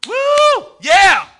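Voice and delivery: loud, high whoop and shout; mock crowd cheer. Mimicking the cheer that was missing.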